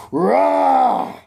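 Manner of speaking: high loud roar; huge Electro roars; monster roar. A narrator's reading. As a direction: roar like a giant beast. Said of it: "He's like".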